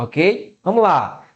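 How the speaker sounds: normal talking voice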